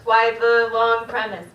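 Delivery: normal talking voice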